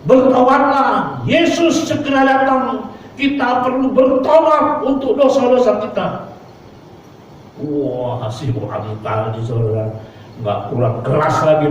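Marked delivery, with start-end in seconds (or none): 0.1-6.1 proclaiming call. Retold or imitated. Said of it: "dan kembali dia berdiri serta berkata".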